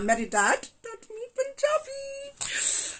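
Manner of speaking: put-on high, slow, drawn-out Punjabi accent. Telling viewers dad taught them, in a playful high Punjabi accent. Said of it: "yeah, I love accents".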